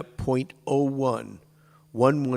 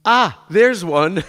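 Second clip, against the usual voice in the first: mock delighted find; 6 semitones higher, 10 dB louder than usual. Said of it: "it's like".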